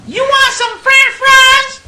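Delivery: very high, slow, loud hammed-up call; mock woman calling out. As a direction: bellow her name across the bus.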